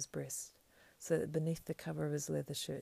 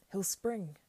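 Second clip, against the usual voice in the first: raised, loud alarm; cried warning; 5 semitones higher, 5 dB louder than usual. A narrator's reading. Servant warning his master of an attack, crying out. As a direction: call it out high and urgent. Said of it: "cried Sam".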